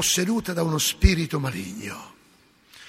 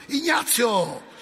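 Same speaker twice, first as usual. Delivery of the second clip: calling out; 6 semitones higher, 5 dB louder, much slower than usual. Retold or imitated. Padre calling him from altar.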